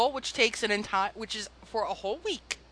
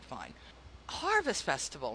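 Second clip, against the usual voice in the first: ordinary delivery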